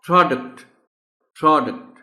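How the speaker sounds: "प्रोडक्ट" high and loud, said twice; pronunciation drill. Shown as a demonstration. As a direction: drill "प्रोडक्ट" firmly for the class. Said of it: "drill of word".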